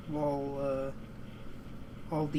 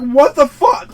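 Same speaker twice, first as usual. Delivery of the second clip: loud, higher, incredulous outburst; disbelieving yell; 7 semitones higher, 16 dB louder, slower than usual. Retold or imitated. Gamer retelling his reaction to the movie, loud disbelieving yell. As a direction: blurt it out in shock and outrage. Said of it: "I was like".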